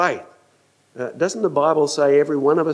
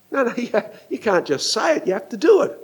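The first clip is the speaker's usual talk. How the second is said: usual speaking voice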